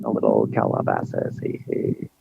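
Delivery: very low, slow creak; creaky Calabasas parody, bottom of the voice; mock Calabasas fry. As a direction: drag it out in imitated vocal fry. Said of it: "It's a Calabasas thing".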